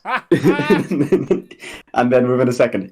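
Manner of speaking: ordinary talk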